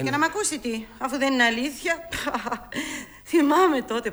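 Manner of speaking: mocking him loudly with a laugh; loud teasing that others could overhear; too loud. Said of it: "πιο σιγά σου λέω".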